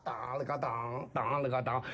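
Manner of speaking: vocalised rhythmic clapper beat, low; clapper-beat imitation. Shown as a demonstration. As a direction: chant the kuaishu clapper rhythm by mouth. Mouths kuaishu clapper beat. (嘴里打山东快书板点)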